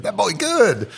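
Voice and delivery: high, lightly voiced old barber; a hint of the Coming to America barbershop; Coming to America old man. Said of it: "Coming to America ... it's like".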